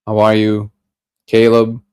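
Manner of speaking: normal talking voice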